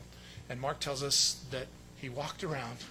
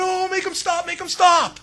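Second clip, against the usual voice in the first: much higher, frantic mock panic; panicked priests; 14 semitones higher, 11 dB louder than usual. Preacher mimics panicking priests, higher and frantic. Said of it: "priests are running around going".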